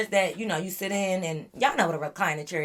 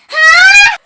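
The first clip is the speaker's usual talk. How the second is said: piercing high, very loud shriek; imitated waking scream; 20 dB louder than usual. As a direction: scream it like a startled sleeper. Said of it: "Wait, stop screaming".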